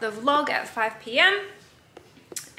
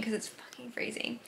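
whisper; 12 dB quieter than usual. Real, not acted. Whispered aside about freezing house.

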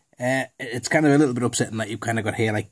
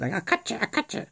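high, soft mock threat; edgy little guy; 9 semitones higher, 5 dB quieter than usual. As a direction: squeak out a pretend knife threat. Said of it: "he looks right little edgy guy".

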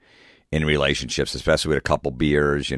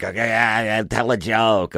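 high, slow sarcastic taunt; mock heckler sneer; 7 semitones higher than usual. Girlfriend heckling the comic, sarcastic mock voice. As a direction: sneer it at the stage. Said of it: "she's yelling at me back".

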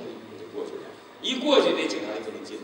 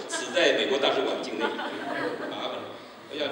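usual speaking voice

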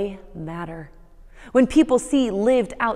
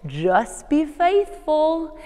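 very slow drawl, a touch higher, mock Southern; 6 semitones higher, much slower than usual. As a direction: savor each word like the professor. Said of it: "he said, you guessed it".

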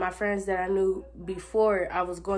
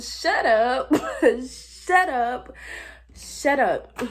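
laughing shut up; 5 semitones higher, 7 dB louder, much slower than usual. Real, not acted. Laughing disbelief at the girl.

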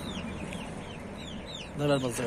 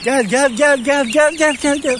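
high, louder sing-song call of gel; calling animals; 7 semitones higher, 4 dB louder, much slower than usual. Real, not acted. Farmer to his geese, high loud calling. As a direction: call the geese in from across the yard.

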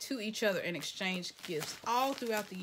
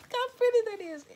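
excited; 13 semitones higher, 7 dB louder, much faster than usual. Real, not acted. Admiring the jacket.

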